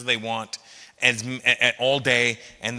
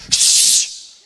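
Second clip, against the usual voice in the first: sharp loud shush; annoyed shush; 7 dB louder than usual. Retold or imitated. Daughter hushing dad from her iPad, loud irritated shush. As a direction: hush dad like an irritated woman. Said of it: "Her reaction was".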